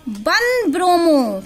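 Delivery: drawn-out, deliberate naming; very slow teacher's dictation. Real, not acted. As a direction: stretch each word out for note-takers. Teacher dictates the compound name.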